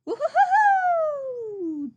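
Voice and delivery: high, loud, drawn-out bouncing whoop; slow loud high hoot; Tigger whoop. Acted.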